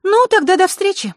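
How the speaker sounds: very high, loud character voice; high-pitched, loud parting; Zakharra, cheery farewell. A narrator's reading. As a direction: call out a goodbye in a high girlish voice. Попрощалась Захара.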